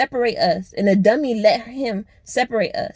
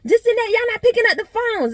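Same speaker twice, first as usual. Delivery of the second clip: high whiny imitation of the friend; mocking the friend; 11 semitones higher than usual. Imitating the friend who complains nobody answered, high and whiny. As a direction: mimic her nagging, pitched way up. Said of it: "She like".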